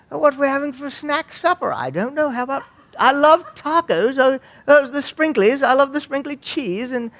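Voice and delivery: high-pitched mock British voice; hammed-up British chatter in a high register; British accent. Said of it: "we spoke British".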